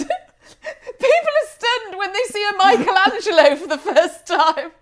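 laughing. Real, not acted. Co-host mock-gushing while laughing.

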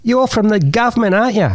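put-on high, suspicious boy; boy accusing the man of being government, high; suspicious kid. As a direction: accuse him like a nosy teen. Kid's accusation voiced in story.

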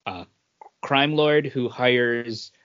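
ordinary delivery

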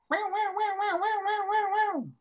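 high-pitched wailing cop-car siren; imitated police siren. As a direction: wail it like a siren, sounding a bit like cat noises. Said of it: "the cop car".

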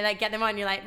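ordinary talk

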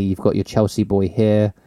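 normal talking voice

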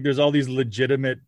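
normal talking voice

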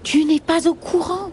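high-pitched serpent voice; hissing snake. Acted. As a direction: hiss the question high, like a snake. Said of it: "siffle un des serpents".

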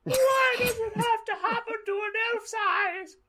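very high crying elf voice; Dobby. Acted. Elf runs away from what he saw, crying shrilly. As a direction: wail in a squeaky falsetto. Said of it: "Dobby ran away crying".

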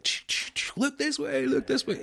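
high, clicking press shout; mock the frantic press pack; imitating photographers calling to a star; paparazzi imitation. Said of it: "the press after a star".

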